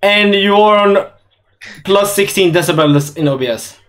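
usual speaking voice

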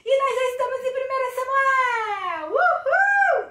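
high, loud whoop of celebration; big hooray, voice thrown up high; excited cheer. Real, not acted. Excited cheer opening kids' Bible lesson.

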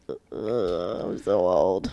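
put-on deep, slow groaning old person. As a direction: drag it out like a weary old man. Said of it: "and I was like".

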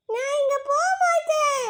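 high, slow stubborn refusal; stubborn kid. Acted. Child refuses the teacher's order to sleep.

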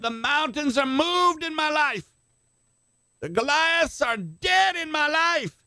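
high, slow, ringing shout of victory; shouted confession. Shown as a demonstration. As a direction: call it out like a battle cry. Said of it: "Say it".

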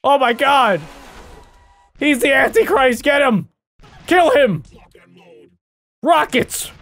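high, loud hammed-up alarm; mock panic. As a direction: shriek like the dead just rose. Ragdoll revives, mock panic.

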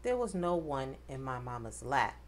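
usual speaking voice

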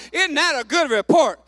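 loud preacher's challenge; exultant exhortation. Real, not acted. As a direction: call it out to stir the crowd. Preacher exhorting congregation to shout.

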